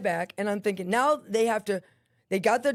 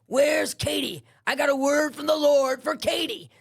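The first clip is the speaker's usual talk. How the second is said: imitating an urgent announcer, higher pitch; calling out; 4 semitones higher, 4 dB louder than usual. Woman telling inmates she has a word from the Lord, calling out. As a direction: announce it insistently to the whole unit.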